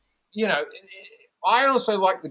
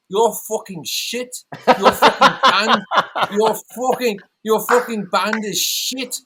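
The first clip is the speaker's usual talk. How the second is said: higher, louder, slow Manc insult; 7 semitones higher, 5 dB louder, slower than usual. Retold or imitated. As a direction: drag out the Manc insult, loud and high. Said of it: "you do such a good fucking impersonation".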